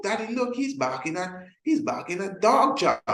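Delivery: put-on high kid voice pointing things out; excited child. Child telling daddy the dog is barking at dog chow, high and eager.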